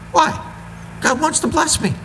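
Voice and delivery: high, silly mocking voice; mock naive believer. Preacher mocking indebted Christians, high silly voice. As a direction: mimic a gullible excuse.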